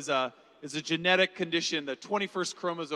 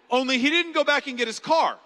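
ordinary talk

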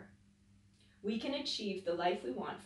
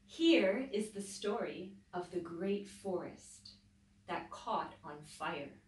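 story narration; 3 dB louder than usual. A narrator's reading. Teacher reading a picture book to children, dramatic. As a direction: read the fire scene with building drama.

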